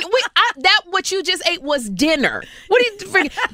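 high-pitched, stammering exasperation; acted-out mom losing patience; exasperated mother. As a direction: sputter in disbelief at a child. Mother scolding son in story.

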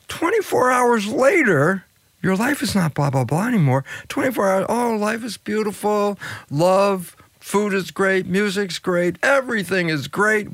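a lightly acted lovestruck glow; giddy bliss. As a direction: gush about life being beautiful. Mimics giddy honeymoon bliss.

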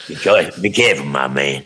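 street informant's warning, dropping low; Huggy Bear. Pretend. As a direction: sign off like a streetwise tipster. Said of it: "do your Huggy Bear".

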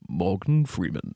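low, slow, measured actor impression; Morgan Freeman. Self-declared master of bad impersonation says the name, slow low Freeman. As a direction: drop the pitch and stretch out the name. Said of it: "Morgan Freeman if you just say his name".

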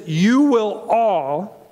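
"You will all" drawn out slowly, with weight on "all"; slow down and land hard on "all"; stressed slowly. Acted. Quoting Jesus, stressing all.

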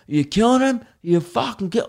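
an angry follower accusing him of killing it, hammed up; mock outraged follower. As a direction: play an appalled follower. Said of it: "people were so angry".

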